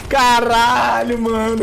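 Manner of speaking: high laugh then slow exclaim. Real, not acted. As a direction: laugh it out amazed. Laughing at double kill.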